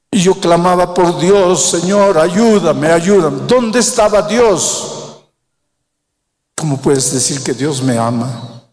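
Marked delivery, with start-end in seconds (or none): 0.1-5.2 louder, higher desperate plea; crying out. Retold or imitated. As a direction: cry out to God in anguish. Mujer recuerda clamar a Dios. (clamor desesperado, más alto y fuerte)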